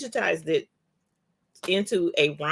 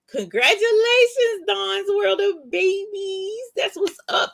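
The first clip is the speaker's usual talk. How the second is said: much higher, loud happy shout-out; excited congratulations; 4 dB louder than usual. Real, not acted. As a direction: cheer her on, voice way up. Congratulating a new template maker.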